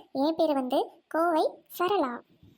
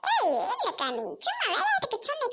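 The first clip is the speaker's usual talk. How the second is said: high put-on character voice; playful protest; 8 semitones higher than usual. Character placates the offended woman. (உச்சக் குரலில் சமாளிப்பு)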